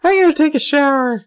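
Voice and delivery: very high, loud mock outburst; mock wail. Host mimics Emily fleeing to the shower, high mock wail. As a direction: belt it out high in an over-the-top imitation. Acting out Emily's escape line.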